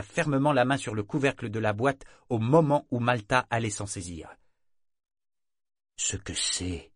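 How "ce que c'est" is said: low, quiet, cold grandmother; icy voice. A narrator's reading. Grandmother blocking the box with an icy voice. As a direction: chill the question. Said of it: "d'une voix glacée".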